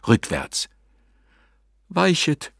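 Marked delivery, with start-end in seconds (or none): 1.9-2.5 frightened cry. A narrator's reading. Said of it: "rief er".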